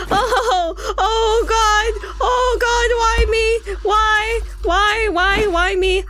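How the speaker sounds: high, drawn-out panicked whine; high-pitched gamer meltdown; panicked wailing. Pretend. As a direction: wail in hammed-up despair. Panicking at timed game task.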